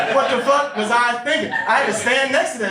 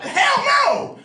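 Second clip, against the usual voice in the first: outraged shout; 14 semitones higher than usual. Real, not acted. Reacting to the friend's breast reduction plan, outraged high shout. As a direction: yell it like it's a crime.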